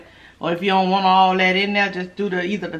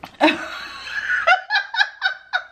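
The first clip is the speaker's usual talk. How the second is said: long, high-pitched burst of laughter; real laughing fit. Real, not acted. Apologising to viewers for laughing so much, real laughing fit. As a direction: laugh helplessly, high and drawn out. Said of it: "smile and laugh and stuff so much".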